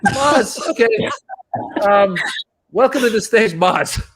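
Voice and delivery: high-pitched, halting mock emcee; imitating a thrown host, with hesitant gaps; flustered host introduction. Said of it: "He goes".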